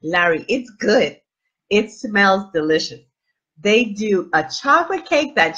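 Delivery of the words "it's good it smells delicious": usual speaking voice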